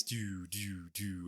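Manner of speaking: soft, evenly spaced "doo" sounds; imitated lights shutting off. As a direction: softly voice each light cutting out.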